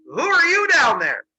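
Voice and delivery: long, high, loud holler; calling down. Retold or imitated. Zeus looks down the pit at unknown creatures, hollered question.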